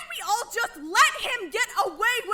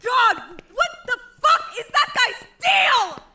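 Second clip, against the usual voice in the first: loud furious outburst, pitched up; angry shout; 5 semitones higher, 4 dB louder than usual. Acted. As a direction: yell out the anger at him. Heated outburst after being branded.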